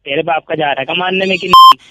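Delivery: usual speaking voice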